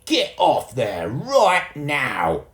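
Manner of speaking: slow, loud, high bellow; angry shout. A narrator's reading. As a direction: roar at the climbing boy.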